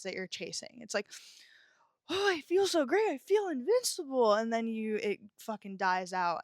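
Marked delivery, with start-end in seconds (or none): 2.1-4.3 high, swooping elated gush; mock euphoria. Woman acting out a drug high for viewers, euphoric. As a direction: play up the rush of feeling on top of the world.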